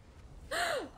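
very high, sharp gasp of shock. Real, not acted. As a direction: gasp as the jump goes wrong. Shock at a big fall.

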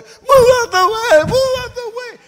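high, loud acted scream at a crowd; frantic yelling. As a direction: shriek to clear a path as a desperate child. Crying children push through crowd.

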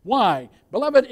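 ordinary talk

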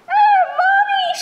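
very high, loud, drawn-out shriek; frightened squeal for help; little girl's scream. A narrator's reading. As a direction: scream for mom like a scared small child. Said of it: "she screamed".

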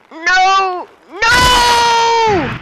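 high-pitched yell building to a long wail; dismayed game yell. Real, not acted. Player watching the attack go wrong, high dismayed shout. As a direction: cry out as the move goes wrong.

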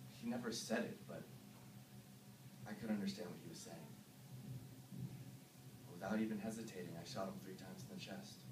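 low, soft, haunted recounting; hushed confession. Acted. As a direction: murmur it low and numb. Confessing killings of villagers.

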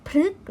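"พฤกษ์" as one syllable, phruek; short ue closed by k in "พฤกษ์"; model reading. Shown as a demonstration. Teacher reading the answer, careful pronunciation. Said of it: "คำนี้จึงอ่านว่า".

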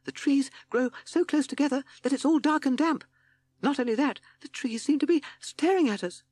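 high, loud, frightened toad voice; timid toad shudder. A narrator's reading. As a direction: fret in a high-pitched toad voice. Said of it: "said timid Toad with a shudder".

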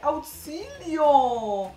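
long, drawn-out plea for help; hammed-up cry of help at a nail video; mock cry for help. Real, not acted. Dragged-out mock "help!" at a nail video.

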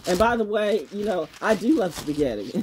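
ordinary talk

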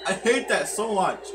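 usual speaking voice

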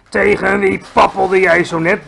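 loud, booming giant demand; bellowing. A narrator's reading. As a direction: thunder the question at a smaller giant. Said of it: "bulderde hij".

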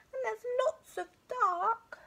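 high, tearful little cub voice; high-pitched teary bear cub; whimpering cub. A narrator's reading. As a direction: let the cub's voice crack with tears. Little Bear upset about dark.

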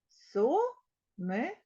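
Сонечко drawn out as Со-не-чко; slow it right down, syllable by syllable; slow syllable split. Shown as a demonstration. Teacher spelling out syllables for the class. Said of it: "Три склади".

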